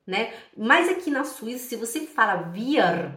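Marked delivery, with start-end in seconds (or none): 2.5-3.1 "wir" with a sounded final R, as the Swiss say it; Swiss-style "wir", R kept at the end; Swiss German pronunciation. Shown as a demonstration. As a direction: say "wir" in a Swiss accent, pulling the R. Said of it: "os suíços falam assim".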